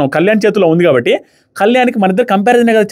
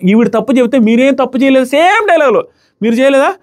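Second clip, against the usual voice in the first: higher-pitched, indignant challenge; 7 semitones higher than usual. Real, not acted. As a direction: confront them on their double standard, pitch climbing. రీతును నిలదీస్తున్న సన్నివేశం. (నిలదీస్తున్నట్టు, గొంతు పెంచి)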